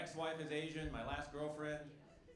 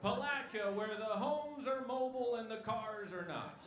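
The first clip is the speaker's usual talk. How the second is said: higher, slow tourism-ad voice; mock slogan; 5 semitones higher, 4 dB louder, slower than usual. Comic mocks the town with a fake slogan, slow ad voice. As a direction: sell the town like a cheesy ad.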